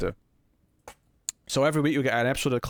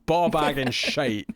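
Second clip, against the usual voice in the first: put-on broad Scottish accent, shite drawn out slowly; full Scottish; 3 semitones higher, slower than usual. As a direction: lay the Scottish accent on thick. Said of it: "I just need to go full Scottish".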